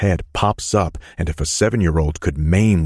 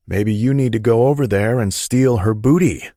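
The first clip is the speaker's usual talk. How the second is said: muttered tease; 6 semitones higher, 4 dB louder than usual. A narrator's reading. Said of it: "Mason mutters".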